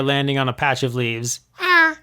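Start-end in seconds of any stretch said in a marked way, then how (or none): 1.6-2.0 high, small deflated creature; a tiny bug let down; squeaky worm voice. A narrator's reading. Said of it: "it said, looking discouraged".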